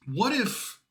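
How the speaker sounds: ordinary delivery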